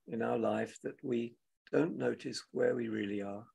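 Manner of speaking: ordinary talk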